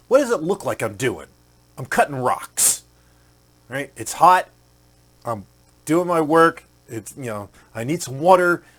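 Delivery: a tired stonecutter waving off a stranger, irritated; miserable worker. Acted. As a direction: snap it out like an overheated worker who wants to be left alone. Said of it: "he was just miserable".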